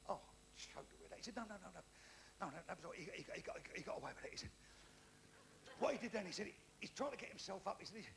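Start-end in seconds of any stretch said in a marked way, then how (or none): 0.1-1.2 hushed, stunned mutter; soft acted shock; mock choked-up dismay. Mate hears of friend's death. 1.3-4.4 soft reassuring correction. Acted. Teller corrects mate's assumption.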